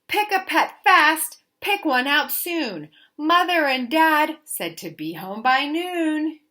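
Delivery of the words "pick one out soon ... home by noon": ordinary delivery, nothing marked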